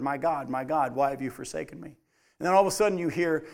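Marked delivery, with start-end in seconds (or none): 0.0-2.0 low, soft and subdued, a hint of anguish; quiet cry from the cross. Passerby overhearing Jesus on the cross, low soft quiet voice. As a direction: let it drift past like something overheard, low and soft. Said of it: "you walk by and heard".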